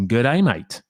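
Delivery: hammy, badly faked Aussie greeting; mock Australian twang, poorly done; bad Australian accent. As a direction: put on a terrible Australian accent. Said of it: "It's the worst accent ever".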